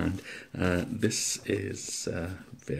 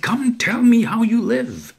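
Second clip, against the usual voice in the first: much higher and much louder yell; full shout; 17 dB louder, slower than usual. Acted. Narrator shaking the old man, yelling at full force. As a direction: bellow it while shaking him. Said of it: "I cried".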